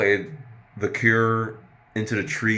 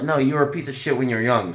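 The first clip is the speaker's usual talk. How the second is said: ordinary talk, nothing marked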